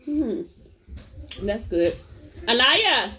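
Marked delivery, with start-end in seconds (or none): 2.5-3.1 a loud high call across the room. Real, not acted. Mom calling out to Anaya across the house, loud and high. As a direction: call her name to reach the next room.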